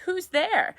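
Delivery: high, thin, mournful squeak; sad squeaky voice. A narrator's reading. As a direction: squeak it out like a sad little man. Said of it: "a sad, squeaky sort of voice".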